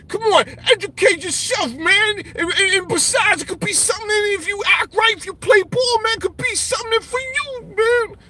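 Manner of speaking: high, sneering and condescending; mocking high voice. Streamer mocking a commenter, high condescending sneer. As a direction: talk down like a smug know-it-all. Mock-reading a hostile chat comment.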